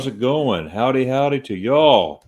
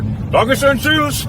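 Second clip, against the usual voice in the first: chirpy German goodbye; 7 dB louder, slower than usual. Real, not acted. German farewell in a cheerful voice.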